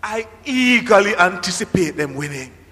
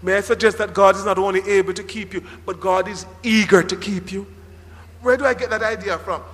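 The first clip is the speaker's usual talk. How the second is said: normal talking voice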